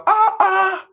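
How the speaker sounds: high, drawn-out mocking "ah, ah"; incredulous ah ah. Real, not acted. Pastor scoffing at the congregation with a high "ah, ah". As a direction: exclaim in disbelief, pitched high.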